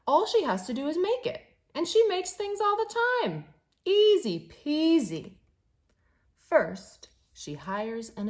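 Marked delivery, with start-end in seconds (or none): 0.1-5.4 higher, faster and louder, full of confidence; breezy confidence. A narrator's reading. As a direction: toss it off like it's nothing. Girl's confident plan, read excitedly.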